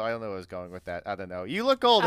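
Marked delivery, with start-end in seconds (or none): 1.4-2.1 captain's blunt retort; gruff captain. Acted. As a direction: bark it like a ship's captain.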